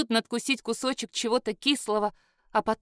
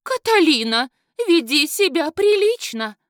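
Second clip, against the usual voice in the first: higher and louder scolding cry; shrieking woman; 5 semitones higher, 6 dB louder than usual. A narrator's reading. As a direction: wail the name, then scold. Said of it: "заголосила молодящаяся женщина". (громко и высоко заголосила)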